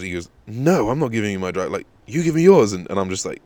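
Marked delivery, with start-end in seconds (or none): 0.5-2.7 higher, louder indignant comeback; mock angry retort. Driver demanding the licence back, indignant.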